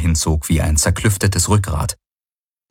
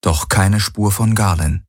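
ordinary delivery

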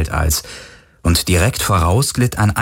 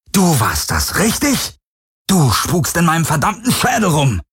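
higher, accusing yell; angry shout; 9 semitones higher than usual. Acted. Brüllt die Helix an. (wütend anklagend geschrien)

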